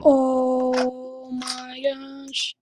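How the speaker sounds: very slow, soft, drawn-out disbelief; hushed, long-held amazement; stunned awe. Real, not acted. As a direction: stretch it out softly, dumbstruck. Stunned by the win.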